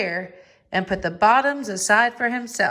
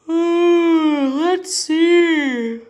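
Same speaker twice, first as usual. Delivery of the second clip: loud stretched yawn, high and far; a sleepy bear yawning loudly; big yawn; 8 semitones higher, 5 dB louder, much slower than usual. Acted. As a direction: yawn the words out, waking slowly. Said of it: "Bear said with a yawn".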